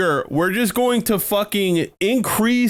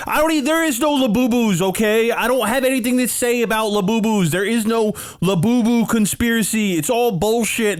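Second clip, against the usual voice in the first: exasperated rant; 7 semitones higher, faster than usual. Real, not acted. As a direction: vent the outrage, voice pitched up. Ranting about Labubus.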